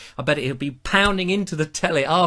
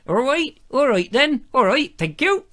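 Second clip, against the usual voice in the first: high-pitched West Country yokel; West Country accent; 10 semitones higher than usual. Shown as a demonstration. Host signing off his West Country turn, high rustic accent. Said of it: "the West Country here and say".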